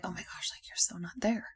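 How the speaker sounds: hushed whispered self-talk, trailing into a laugh; a dismayed whisper to her own stomach. Retold or imitated. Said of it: "I'm like".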